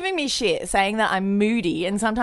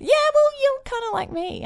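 high voice. Acted. Sudden high pitch.

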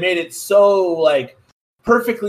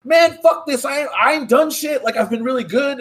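mock-indignant, pitched up; indignant protest; 7 semitones higher than usual. Cornered Bruce protests to the heroes, indignant and higher. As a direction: protest your innocence in a higher voice.

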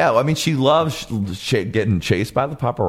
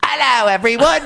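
very high, hammed-up British accent; Adnan; 12 semitones higher, 4 dB louder than usual. Boyfriend greeting everyone, high mock British accent. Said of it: "actually has a British accent".

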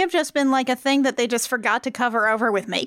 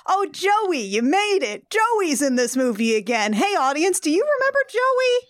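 higher, singsong mock cheer; tag-on dubbed callouts; 7 semitones higher than usual. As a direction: announce the forgotten character to the audience. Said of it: "they even call out, like, some A D R stuff".

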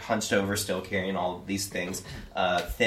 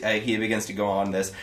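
ordinary talk, nothing marked

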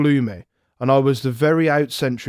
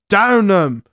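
higher, louder soldiers' roar; roaring battle cry; 6 semitones higher, 5 dB louder than usual. Retold or imitated. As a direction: bellow it as the whole line urges the attack. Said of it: "they roared".

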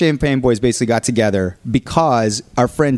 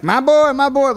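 higher, hearty dad voice; dad's greeting; 12 semitones higher than usual. Retold or imitated. Dad greeting his son, hearty and high. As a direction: greet him like a proud dad.

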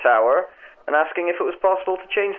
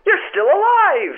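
relieved joy; 13 semitones higher, 4 dB louder, slower than usual. Acted. Friend relieved climber lives.

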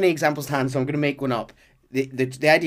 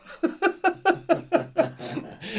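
laughing. Real, not acted. Laughs at Hosea quote.

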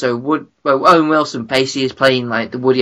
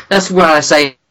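usual speaking voice

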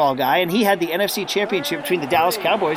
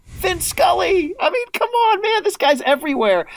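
excited; 11 semitones higher than usual. Real, not acted. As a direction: cry it out in delight. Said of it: "excited praise".

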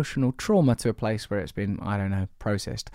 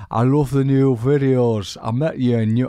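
put-on York accent; 3 semitones higher, 6 dB louder than usual. Co-host reads a listener's email in a put-on York accent. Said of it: "That's how people in York talk".

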